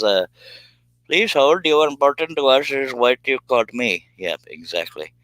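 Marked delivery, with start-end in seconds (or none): 1.1-4.1 call center hold voice. Retold or imitated. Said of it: "Josh Grover says".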